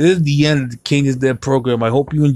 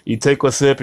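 ordinary talk

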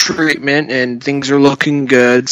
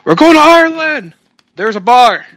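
high, loud hammed-up dad; playful dad impression; mock dad; 10 semitones higher, 4 dB louder than usual. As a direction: sell the pub plan like a giddy dad.

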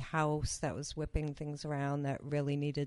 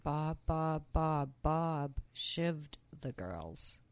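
backwards speech; much slower than usual. Retold or imitated. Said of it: "what's it saying?".

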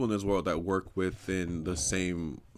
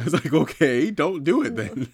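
laughing, higher and louder; 5 semitones higher, 6 dB louder than usual. Real, not acted. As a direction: laugh through the retort. Laughing retort about the sushi critics.

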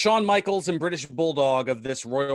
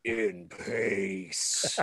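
put-on low, soft British Undertaker accent; 7 semitones lower, 5 dB quieter than usual. Guest finishing a joke tribute-act catchphrase, deep British Undertaker accent. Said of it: "That was my British taker accent".

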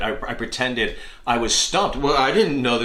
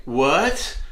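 feigned innocence; 6 semitones higher, slower than usual. Pretend. Fake innocence after tripping.

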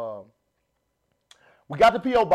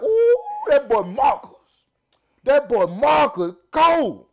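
slow, raised hype with stretched words; impressed fans; 7 semitones higher, 5 dB louder, slower than usual. Pretend. Imagining viewers praising his acting, drawn-out admiring hype. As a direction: play an audience hyping him up. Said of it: "y'all gonna be like".

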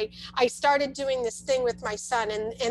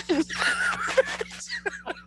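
high laugh. Real, not acted. Laughs at her own story.

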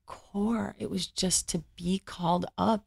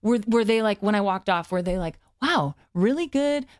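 higher, louder frantic second-guessing; anxious imagined verdict; 4 semitones higher, 3 dB louder than usual. Retold or imitated. Replaying the producers' reaction in a frantic, higher voice. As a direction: spiral through the possibilities, panicked.

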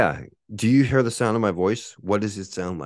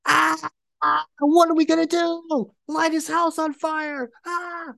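much higher, sneering caricature of a hater; mock the haters in a much higher cartoon voice; 5 dB louder, slower than usual. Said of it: "the haters like".

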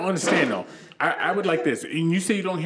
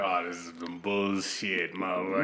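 mock a doubting friend, dragged out and low; imitated grumbling disbelief; dismissive skeptic; 10 semitones lower, 7 dB quieter than usual.

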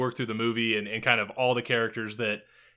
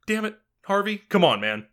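high start, dropping into a louder plea; mock exasperation; 8 semitones higher, 3 dB louder than usual. Film fan scolding actor over accent, mock-exasperated. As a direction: plead as if let down, half-joking.

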